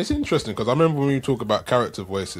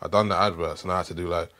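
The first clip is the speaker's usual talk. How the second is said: ordinary delivery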